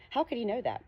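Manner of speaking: ordinary delivery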